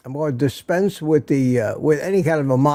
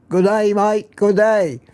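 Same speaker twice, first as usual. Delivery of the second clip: higher-pitched mock Australian greeting; Aussie accent; 4 semitones higher than usual. Host greets a viewer in Australia, playful Aussie accent. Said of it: "how to speak Aussie".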